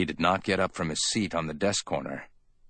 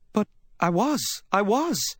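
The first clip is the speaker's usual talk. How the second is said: very high, insistent woman's protest; 3 dB louder than usual. A narrator's reading. Woman defending her alibi to Sam, insistent. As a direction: protest with the pitch shooting up.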